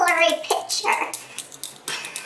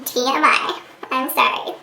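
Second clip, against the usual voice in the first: ordinary talk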